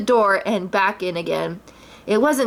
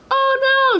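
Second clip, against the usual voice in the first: much higher, louder cry; alarmed shout; 3 dB louder than usual. A narrator's reading. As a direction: Rabbit sees Pooh charging. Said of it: "shouted Rabbit".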